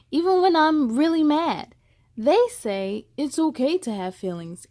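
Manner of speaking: expressive picture-book reading; story reading. Acted. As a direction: read to children, lively. Reads the story text.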